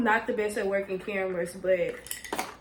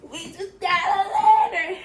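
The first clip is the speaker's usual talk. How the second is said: higher and louder, thrilled; excited repeat; 7 semitones higher, 6 dB louder than usual. Real, not acted. Vlogger repeating her line to viewers, thrilled. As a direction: say it again bursting with joy.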